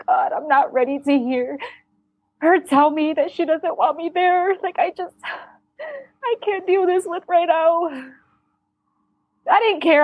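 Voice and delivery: high, voice cracking near tears; fighting back tears, breaking at the end; tearful. Retold or imitated. Daughter in the ambulance, dreading mom's rejection, tearful and high. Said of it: "I'm crying on the way to the hospital".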